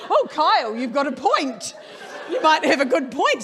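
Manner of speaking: loud, laughing through the words; laughing concession. Real, not acted. Owner conceding to the blunt vet, laughing loudly. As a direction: give in while cracking up.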